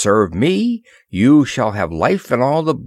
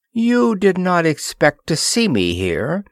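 a mildly harsh queen, pitched higher; 8 semitones higher than usual. Acted. Queen Bowada confronting the prisoners, mildly harsh. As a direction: cut at them with a touch of scorn. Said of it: "she said harshly".

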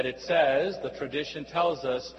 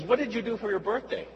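a higher, quick friendly question; friend asking; 7 semitones higher, faster than usual. Retold or imitated. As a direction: toss the question brightly as the friend. Friend asks, voiced higher.

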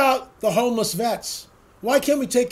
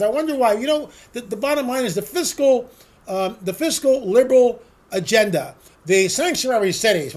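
usual speaking voice

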